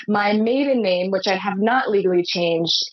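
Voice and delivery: ordinary delivery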